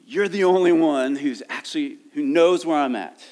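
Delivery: earnest acted cry for help. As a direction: call out to God as the psalmist. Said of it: "psalmist who is crying out".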